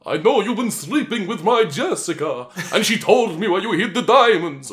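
high, dramatic vampire soap voice; overplayed daytime villain; soap opera vampire. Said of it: "vampire soap opera".

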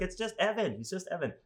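calm the dog, sweet and high; reassuring the dog. Retold or imitated. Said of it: "And then I was like".

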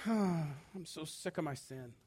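sighing, slow, hushed complaint; sigh, then murmur it low and slow; mock weary lament. Sinner just crying out about sin, hushed weary sigh. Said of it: "just cry out".

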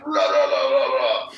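garbled mock shouting from a passing car; imitated garbled yelling. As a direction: mimic unintelligible yelling with a laugh. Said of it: "you just hear".

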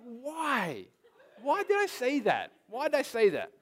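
high, drawn-out exasperated why; hammed-up regret, pitched high and falling; baffled self-questioning. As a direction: replay your own dismay, mock-anguished. Acts out his baffled self-questioning.

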